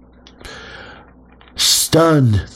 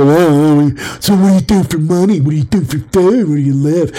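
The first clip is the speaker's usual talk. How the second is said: higher-pitched mocking interrogator; imitating nosy small talk; 6 semitones higher, 5 dB louder than usual. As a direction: parody someone prying with stock questions. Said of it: "Anybody who talks like that".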